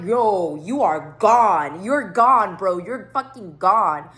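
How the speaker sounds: slow, loud, drawn-out verdict; exasperated, punched-out scolding; emphatic telling-off. Retold or imitated. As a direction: land it hard, word by word. Acted telling-off of a friend.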